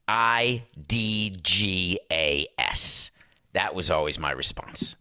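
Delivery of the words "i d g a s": each letter drawn out; slow, spelled out. Real, not acted. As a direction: spell it out slowly, dismissively. Spelled-out dismissive reply.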